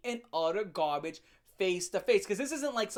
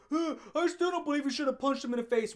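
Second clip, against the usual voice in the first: quick higher voiced commenter; mock commenter; 6 semitones higher, faster than usual. Vlogger imitating a doubting commenter, quick and higher. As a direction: sound like a smug doubter. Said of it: "I'll be like".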